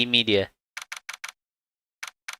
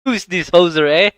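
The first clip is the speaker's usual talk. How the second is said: put-on louder, higher Canadian accent; 6 semitones higher, 7 dB louder than usual. Retrying the line in a hammed-up Canadian accent. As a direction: play up the Canadian on hoser and eh.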